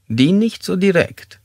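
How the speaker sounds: high, slow character voice; tormented suspect. Acted.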